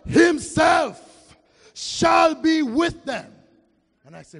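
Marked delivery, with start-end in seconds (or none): 0.1-3.3 loud, slow shouted scripture; preaching at full force. Real, not acted. Preacher reading Revelation to the church, full-force shouting. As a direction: thunder the verse out.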